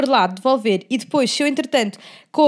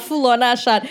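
ordinary delivery

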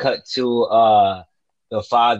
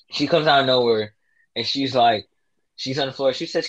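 normal talking voice

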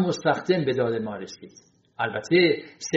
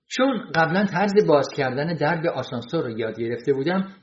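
plain reading voice

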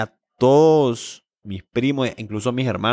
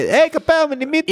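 high squeaky mocking voice; mock greeting; 13 semitones higher than usual. Mocking a schoolmate's greeting in a high voice. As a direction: squeak it out teasingly.

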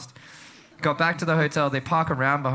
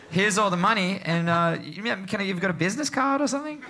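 feigned politeness; 6 semitones higher than usual. Retold or imitated. Feigned cheer paying armed men.

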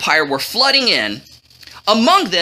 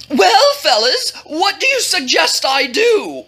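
Luke Skywalker whine; 7 semitones higher than usual. A narrator's reading. King asks his men for advice, whining. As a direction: whine it out like a sulky hero. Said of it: "whined the king like Luke Skywalker".

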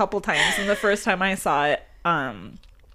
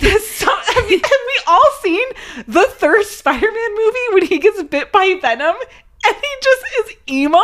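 high, laughing disbelief; laughing rant; 10 semitones higher than usual. Real, not acted. Ranting to her co-host, laughing in disbelief. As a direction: laugh through it, pitched way up.

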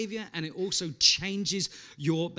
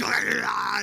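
loud mock roar of rage; hammed-up thunderous snarl; monster roar; 2 semitones higher, 5 dB louder than usual. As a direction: freak out in a wordless monster growl. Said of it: "turning into some kind of monster".

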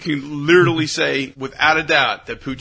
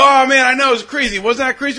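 higher, louder, playing it off; excited; 7 semitones higher, 4 dB louder than usual. To the woman's boyfriends after spilling beer, high excited. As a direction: act thrilled to dodge blame. Himself playing innocent to boys.

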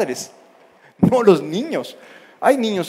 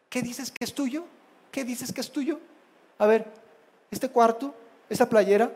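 soft, higher questioning; calm parent; 5 semitones higher, 7 dB quieter than usual. Acted. Parent questions child about what is theirs, softly probing. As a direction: quietly challenge with rising questions. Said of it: "Llega un padre, un hombre de Dios".

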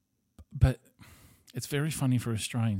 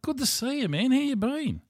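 hammed-up overjoyed hello, pitched way up; delighted greeting; 3 dB louder than usual. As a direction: gush as if greeting someone you really care about. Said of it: "that we really cared about".

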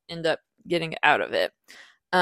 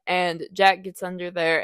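ordinary talk, nothing marked

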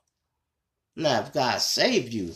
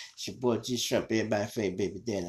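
usual speaking voice

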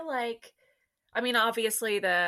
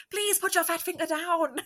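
Heavyweights quote; 7 semitones higher, 3 dB louder than usual. Retold or imitated. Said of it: "I was gonna say".

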